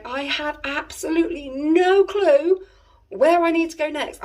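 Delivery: high, slow, drawn-out wail of helplessness; exasperated despair. Retold or imitated. Lost driver circling a roundabout at night, high exasperated wail. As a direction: stretch it out, totally lost.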